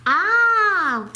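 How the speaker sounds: high, loud bright ah; delighted ah. A narrator's reading. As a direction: jump at the idea. Mouse delighted.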